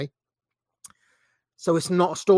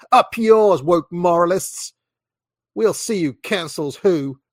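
mock gloating; 2 semitones higher, 5 dB louder, slower than usual. Mocking gloating fans.

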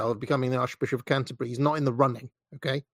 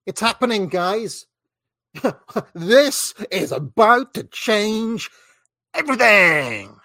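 mock hype; 5 semitones higher, 4 dB louder, slower than usual. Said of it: "Josh Dunlap says".

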